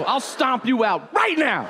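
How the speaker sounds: high, slow shouted threat; mock threatening shout. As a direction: yell a hammed-up threat at a kid. Retold threat to a child.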